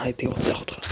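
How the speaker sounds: mock threat. Real, not acted.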